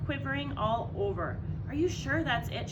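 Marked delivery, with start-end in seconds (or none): none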